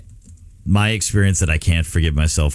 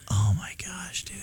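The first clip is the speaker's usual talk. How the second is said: stunned realization; 10 semitones higher, 11 dB quieter than usual. Retold or imitated. Realizing he dropped son late.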